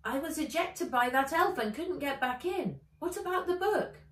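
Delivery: light, chirpy creature voice; small dragon. Acted.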